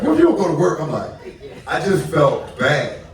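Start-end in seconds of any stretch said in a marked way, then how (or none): 0.0-0.8 wife's retort, much higher and louder; mock wife. Wife on the bed snapping back at her husband, high and loud. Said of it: "She's like".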